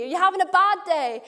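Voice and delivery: ordinary delivery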